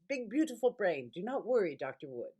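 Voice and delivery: doctor's reassuring words in an imitated Indian accent. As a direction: quote the neurologist's Indian accent fondly. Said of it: "He's Indian and beautiful accent".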